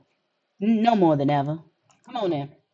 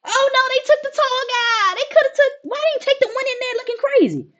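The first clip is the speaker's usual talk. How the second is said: squeaky high-pitched cartoon voice; high goofy voice. A goofy bystander mocking the guards, high squeaky voice. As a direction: play it like a silly onlooker, way up high. Voicing a goofy character reaction.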